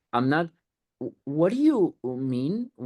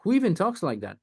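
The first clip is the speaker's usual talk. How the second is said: incredulous; 8 semitones higher than usual. Retold or imitated. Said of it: "He's like".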